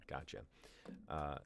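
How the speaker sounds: ordinary delivery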